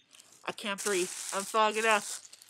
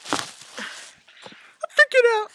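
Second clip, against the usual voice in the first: loud, very high, drawn-out wail; panicked wail; 11 dB louder, much slower than usual. Real, not acted. Freaking out while doing the bird challenge, wailing in panic. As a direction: shriek it slowly in real panic.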